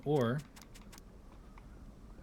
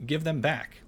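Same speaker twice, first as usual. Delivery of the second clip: seething; 6 semitones higher, 6 dB louder, slower than usual. Acted. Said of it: "seethed the secret keeper".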